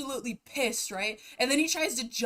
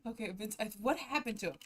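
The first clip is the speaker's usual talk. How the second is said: ordinary delivery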